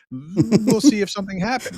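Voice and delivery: mocking wait-and-see; sarcastic doubt. Real, not acted. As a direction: toss it off with disbelief. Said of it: "sarcastic doubt".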